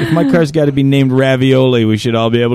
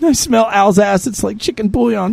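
mock stroke victim; 8 semitones higher than usual. Man riffing on his stroke bit, high stricken voice. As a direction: comically act out a stroke. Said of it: "No, that's a stroke, dummy".

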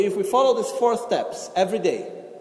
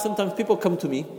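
ordinary delivery

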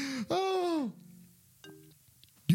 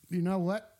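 deep voice; 10 semitones lower than usual. Acted. Drops into deep voice.

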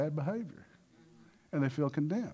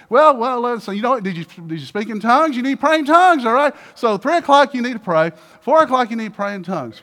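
high, loud pushy instructions; mock preachy shout; 7 semitones higher, 11 dB louder than usual. Church adviser pressing tongues on him, loud mock insistence. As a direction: lay down the rules loudly.

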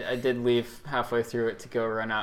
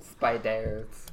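put-on French accent; 3 semitones lower than usual. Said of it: "you do a terrible French accent".

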